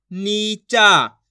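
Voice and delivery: नीचा very slow and loud, both vowels long; syllable by syllable. Shown as a demonstration. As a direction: draw out नी, then चा, in नीचा. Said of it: "एक एक अक्षर का उच्चारण". (नीचा को ज़ोर से, खींचकर)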